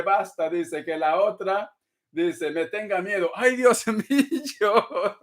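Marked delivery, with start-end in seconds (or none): 3.4-5.2 slow, laughing through the words; amused "ay Dios mío" with a laugh inside; laughing exclamation. Real, not acted. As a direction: break into laughter mid-phrase. Amused at viewer's vengeful comment.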